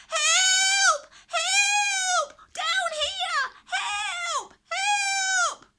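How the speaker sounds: high, loud panicked shrieking; desperate shrieks. A narrator's reading. Caged villagers shriek to the knight for help, panicked. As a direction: scream for rescue at the top of your lungs. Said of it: "all shrieking at the top of their voices".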